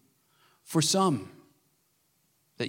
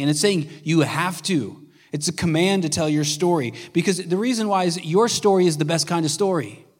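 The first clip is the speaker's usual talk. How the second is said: louder, higher preaching build; rising, fervent sermon delivery; full-force preaching; 4 semitones higher, 5 dB louder than usual. Acted. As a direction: drive the point home to the congregation.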